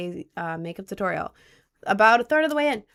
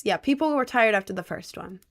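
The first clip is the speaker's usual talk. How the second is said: ordinary talk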